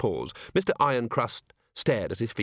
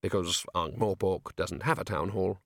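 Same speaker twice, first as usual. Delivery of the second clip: ordinary delivery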